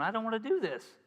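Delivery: put-on high whiny toddler protest; cranky toddler. Pastor mocking his own complaining, as a whiny toddler. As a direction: stamp your feet like a sulky toddler. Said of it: "I'm just a cranky little toddler".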